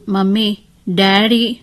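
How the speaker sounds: put-on slow, drawn-out little-girl call; child calling out. Just-woken girl calling for mummy and daddy, plaintively. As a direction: stretch the words like a waking child. Waking child calls for parents.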